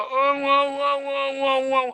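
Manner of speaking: high mock toddler cry; high, whiny baby cry of wow wow; imitated child wailing. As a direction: wail like a little kid who can't say what he wants.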